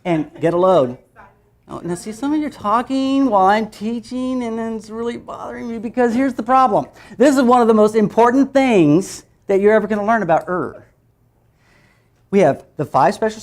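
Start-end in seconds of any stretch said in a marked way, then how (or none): 1.7-10.8 mock scolding. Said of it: "mock scolding the class".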